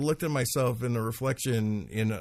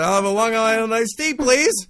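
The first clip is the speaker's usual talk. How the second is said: naive order; 10 semitones higher, 10 dB louder than usual. Retold or imitated. Naive kid ordering in bar.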